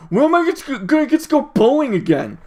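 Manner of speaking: loud, high mock whine; exaggerated griping, blurted out; mock complaining. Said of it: "and people were like".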